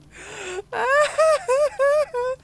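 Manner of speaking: squealing laugh fit; laughing till crying; high laughter. Real, not acted. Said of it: "I can't stop laughing".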